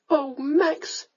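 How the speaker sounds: high emotional outcry; crying out. A narrator's reading. Young lady cries out to the lord, emotionally. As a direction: cry his name, voice lifted high. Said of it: "she cried".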